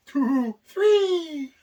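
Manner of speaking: high, wavering ghostly voice. Real, not acted. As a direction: moan it like a spooky ghost. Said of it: "Why do you sound like a freaking ghost".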